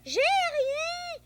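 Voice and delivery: high and loud, protesting; calling back to a grown-up; defensive answer. Real, not acted. Boy answers parent, defensive.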